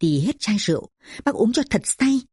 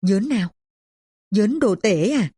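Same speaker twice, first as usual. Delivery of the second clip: mild surprise; understated astonished query; surprised questioning; 3 semitones higher than usual. A narrator's reading. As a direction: ask in slight puzzlement who is meant. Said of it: "ngạc nhiên hỏi".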